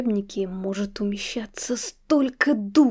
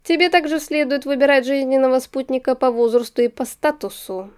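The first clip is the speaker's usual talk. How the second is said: confident aristocratic suitor; lordly lecturing voice; Persival; 3 semitones higher, 5 dB louder than usual. Acted. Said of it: "продолжил лорд Туаретонг".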